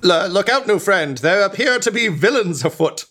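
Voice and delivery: high, loud, alarmed warning; high alarmed skeleton voice. Acted. Skeleton warns of villains.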